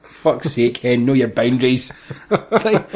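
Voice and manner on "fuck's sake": ordinary delivery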